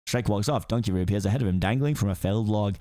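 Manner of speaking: ordinary talk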